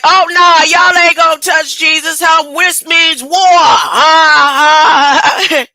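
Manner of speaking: loud, high war cry from Peter; fired-up Peter calling for war; Peter ready to fight. Retold or imitated. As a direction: shout it like a man drawing his sword. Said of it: "Peter was like".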